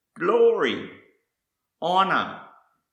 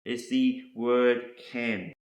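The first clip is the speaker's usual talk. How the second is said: ordinary talk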